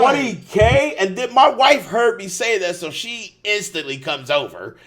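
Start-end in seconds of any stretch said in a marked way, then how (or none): 0.0-0.9 high, loud disbelieving repeat; incredulous yelp; shocked echo. Retold or imitated. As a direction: say it like you can't believe the number.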